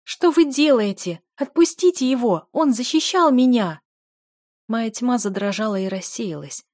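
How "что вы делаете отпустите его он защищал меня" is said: high, loud protest; desperate shouting. A narrator's reading. Дель pleading with guards for her defender, shouting. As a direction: cry out to make them stop. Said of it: "закричала Дель".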